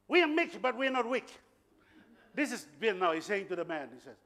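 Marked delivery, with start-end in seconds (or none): 0.1-1.3 high-pitched imitated Angan accent; raised, emphatic Angan accent; powerful Angan accent. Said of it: "in a powerful Angan accent".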